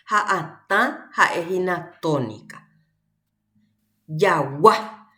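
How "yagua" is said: Yagua, loud on the last A; stress on final A. Shown as a demonstration. Teacher quizzing class on tonic vowel, stress on final A. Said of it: "la vocal tónica".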